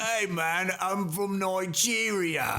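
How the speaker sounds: high, drawn-out mock Nigerian accent; hammed-up fake Nigerian accent; botched Nigerian accent. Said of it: "Just do your own voice".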